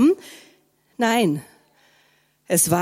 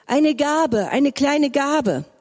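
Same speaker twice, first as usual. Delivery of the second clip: begging beggar; 6 semitones higher, slower than usual. A narrator's reading. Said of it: "Ein Bettler rief zu ihm".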